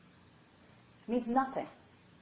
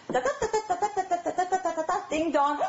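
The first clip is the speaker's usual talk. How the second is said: high sing-song babbling; pitched-up nonsense chatter; chatter babble; 9 semitones higher than usual. Pretend. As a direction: mimic a chatty phone call. Mimics phone chatter then doorbell.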